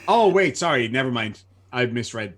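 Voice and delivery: ordinary talk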